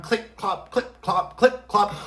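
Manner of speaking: crisp high-heel click-clop sound effect; imitated clip-clop of heels. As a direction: tap out the heels in singsong syllables. Imitates heels clopping on floor.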